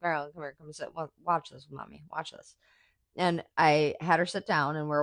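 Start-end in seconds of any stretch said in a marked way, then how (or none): 0.0-2.5 softer, coaxing mommy voice; soft calling. Retold or imitated. Mom softly calling her daughter to watch. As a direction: gently coax her over. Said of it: "I was like".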